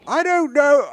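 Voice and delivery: high-pitched attempt at Irish; bad Irish accent. Lost couple on the train, bad Irish accent. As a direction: try a lilting, badly faked Irish accent. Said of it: "I can't do Irish accent".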